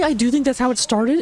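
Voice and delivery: ordinary talk, nothing marked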